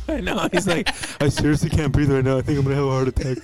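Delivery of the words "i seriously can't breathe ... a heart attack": rushed mock panic. Imitating breathless Dennis.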